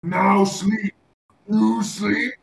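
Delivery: loud, broken-speech creature voice; booming creature voice; monster giving orders. Acted. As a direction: command it like a big simple beast. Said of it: "looks you in the eyes and goes".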